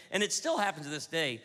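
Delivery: normal talking voice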